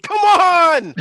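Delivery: loud, high, drawn-out yell; frustrated shout. Real, not acted. Player pleading with the dice, loud high shout. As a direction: holler it at the dice, pitch way up.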